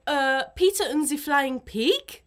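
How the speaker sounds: put-on halting exam answer in a German accent. Friend retells a botched German oral exam answer, mock German accent. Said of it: "replied in German".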